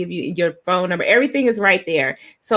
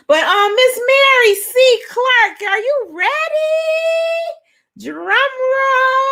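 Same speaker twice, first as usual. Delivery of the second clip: high, bright host call-out to the next guest; excited announcing; 4 dB louder, slower than usual. Real, not acted. Host calling the guest on stage, high excited voice. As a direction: hype up the guest's entrance.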